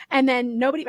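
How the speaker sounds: ordinary talk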